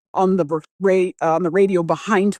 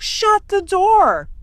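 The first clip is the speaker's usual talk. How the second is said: high, slow, drawn-out yell; panicked shout; 14 semitones higher, 4 dB louder, much slower than usual. Retold or imitated. Friend begging her to shut the door, high drawn-out shout. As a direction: cry it out, stretching each word. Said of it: "I reach for the door".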